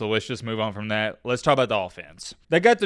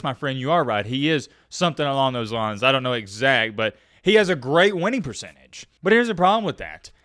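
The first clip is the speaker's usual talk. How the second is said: normal talking voice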